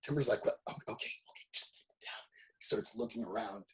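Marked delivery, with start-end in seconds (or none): 0.5-2.2 urgent, soft whisper; panicked hushing. Acted. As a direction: whisper nervously to hush them. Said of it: "terrified, in a panic".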